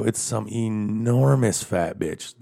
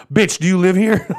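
high, loud taunting question; imagine throwing her own line back at her; mock confrontation; 10 semitones higher, 6 dB louder than usual. Man imagining taunting the woman with her own question, loud. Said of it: "wanna pull up behind her and be like".

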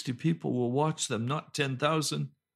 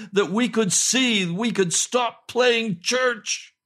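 high, loud, impassioned sermon; full-force preaching; 10 semitones higher, 6 dB louder than usual. Real, not acted. Preacher proclaims blind Bartimaeus healed, preaching at full force. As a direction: proclaim it with rising fervor.

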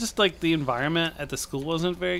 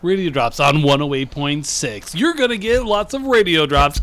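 louder, hammed-up DJ read; mock radio promo; 5 dB louder than usual. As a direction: sell it like a radio jingle.

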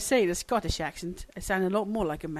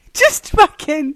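loud, high, drawn-out frustration; exasperated; 6 semitones higher, 12 dB louder, slower than usual. Real, not acted. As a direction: lose patience, loud and slow. Losing patience with count-in.

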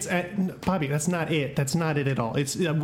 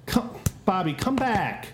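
normal talking voice

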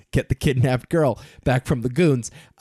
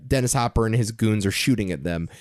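ordinary talk, nothing marked

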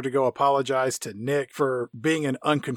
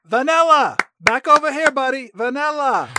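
higher, louder call across the yard; 8 semitones higher, 3 dB louder than usual. Acted. Neighbour calling [name] back to the house, with a loud friendly call.

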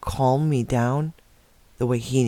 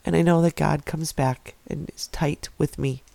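real emotion welling up; 3 semitones higher than usual. Real, not acted. Telling viewers how God never let go, emotional and near tears. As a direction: let the emotion catch in your throat as you speak. Tearful testimony about God's presence.

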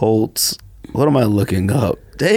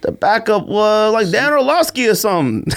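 high-pitched argument; 9 semitones higher than usual. Real, not acted. Grasping for the backup's name, high-pitched. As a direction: push the point in a raised pitch. Arguing over who was quarterback.

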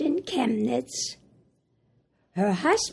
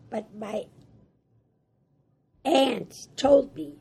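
ordinary delivery

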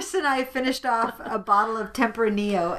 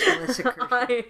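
ordinary talk, nothing marked

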